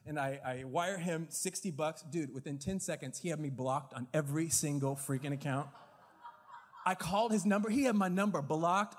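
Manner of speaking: ordinary talk, nothing marked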